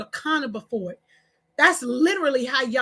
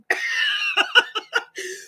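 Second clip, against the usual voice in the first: hearty laugh; 3 semitones higher than usual. Real, not acted. Vlogger breaking off her rant, laughing hard. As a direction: laugh it out in quick bursts.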